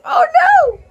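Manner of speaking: high, loud oh no; alarmed cry. Real, not acted. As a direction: cry out as if it's real danger. Peter about to walk plank.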